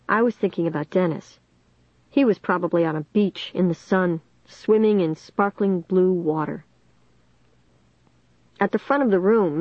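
ordinary delivery

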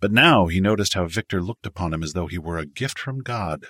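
usual speaking voice

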